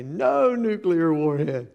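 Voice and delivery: put-on husband teasing his wife with the toddler's line; joking toddler quote. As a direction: tease your wife with the family joke. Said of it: "I'll look at Kathy and I'll say".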